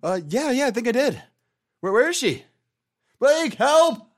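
high, loud in-character alarm building to a cry; acted panic, rising to a shout; panicked calling. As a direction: cry out for a missing friend. Huron panics, calls for Blake.

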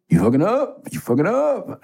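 high, repeated scolding voice; mock mentor's nagging warning; Walker Martin scolding. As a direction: imitate a mentor warning you. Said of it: "Walker Martin used to say".

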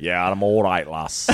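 gruff reply half laughing; northern accent. Retold or imitated. Ned answering Arya, northern accent with laugh. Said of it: "That's exactly how they talk".

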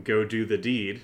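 ordinary talk

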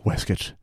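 waistcoat said as WESS-kit; British traditional pronunciation. Shown as a demonstration. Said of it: "to our U K listeners".

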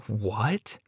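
soft, bewildered question; hushed confusion with rising tone; utterly at a loss. A narrator's reading. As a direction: ask it quietly, completely baffled. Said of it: "utterly at a loss".

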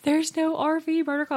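high, loud, imitating a crying kid; crying child. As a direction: wail it like an upset little girl. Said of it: "She melted into the floorboard and started crying".

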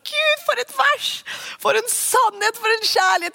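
high, slow gasp of wonder at a Bible verse; overwhelmed, soaring exclamation of awe; awed delight. Pretend. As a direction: marvel at the verse as if it takes your breath away. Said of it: "og du bare ser bare".

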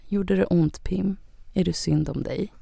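soft, sugary mock sympathy; fake pity. The boyfriend mocking her with soft, fake pity. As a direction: coo it with false puppy eyes. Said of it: "kollar han på mig med så här puppy eyes".

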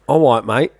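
"All right" said as "awwight" in London accent; London accent, W sound in "right". Shown as a demonstration. Demonstrating the example word, London accent. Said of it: "confused between Australian and London accent".

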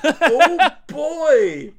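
high, loud laughing cry; laughing exclamation. Real, not acted. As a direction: laugh it out, delighted.

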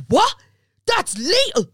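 high mock disbelief, laughing; incredulous fella. Mocking her boyfriend's shocked reaction, high and laughing. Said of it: "And they were like".

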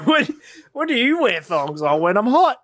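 high, loud acted exchange; retold comedy dialogue. Finishing a stand-up routine for a friend, hammed-up. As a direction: play both sides of the bit.

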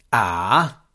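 a short eager "Ah!", lightly voiced; exclamation. A narrator's reading. Drolight struck by an idea, a mild exclamation. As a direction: seize on the idea with a quick burst. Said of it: "esclamò Drolight".

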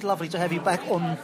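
ordinary delivery

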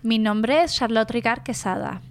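Spanish pronunciation with tapped r's; Spanish version. Shown as a demonstration. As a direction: introduce yourself as a Spanish speaker would. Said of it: "I'll say it in the four languages".

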